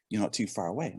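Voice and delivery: repeated softly, voiced but hushed; quiet repeat. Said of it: "He said".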